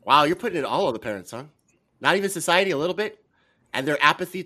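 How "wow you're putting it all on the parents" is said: ordinary delivery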